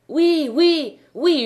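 cris aigus et forts d'une foule; foule qui hurle. A narrator's reading. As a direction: hurlez oui comme toute une foule. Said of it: "hurlèrent les voix". (hurlement de foule)

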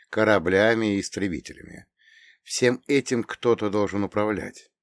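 ordinary delivery

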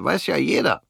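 höhere, schlichte Stimme eines kleinen Mannes; kleiner Mann. Acted. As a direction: als harmloser kleiner Kerl von seinem Schicksal erzählen. Der kleine Mann grinst. (der kleine Mann erzählt Fritz von der Front, mit höherer Stimme)

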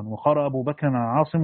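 ordinary talk

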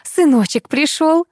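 a light motherly cluck, high and drawn out. A narrator's reading. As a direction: cluck slowly over your darling son. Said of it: "закудахтала Лариса". (кудахчущая мамаша, слегка)

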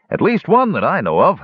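a hint of a laughing old host reminiscing; innkeeper Muldoon. Muldoon voiced.

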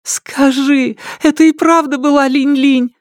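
very high, desperate woman's plea; imploring. A narrator's reading. Woman keeps asking after her daughter, imploring. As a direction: beg for the answer in a high voice. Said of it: "умоляющим голосом спрашивала она".

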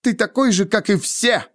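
high-pitched bitter accusation; angry disappointment. A narrator's reading. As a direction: fling it at him, hurt. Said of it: "Движения её были резкими и сердитыми". (сердито, с горькой обидой)

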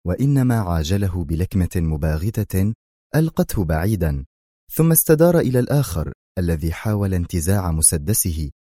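plain reading voice

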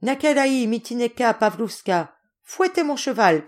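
rally the others in a high voice; calling out. Acted. Yegor telling his brothers to whip his horse, calling out. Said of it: "criait Yegor".

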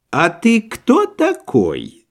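slow, high, insolent sneer. A narrator's reading. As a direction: sneer the question down at him. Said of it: "с дерзким взором". (дерзкий тон)

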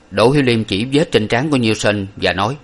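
ordinary delivery

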